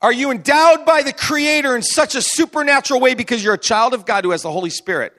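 ordinary talk

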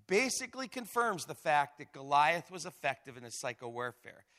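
ordinary delivery

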